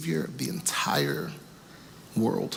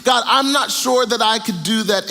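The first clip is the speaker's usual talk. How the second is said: higher, louder, pleading frustration building; frustrated Abraham; 8 semitones higher, 6 dB louder than usual. Acted. Abraham questions God late at night, frustrated and pleading. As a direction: cry out at God, exasperated and pushing. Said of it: "Abraham is so frustrated".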